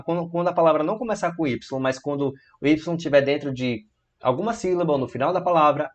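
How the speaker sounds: ordinary delivery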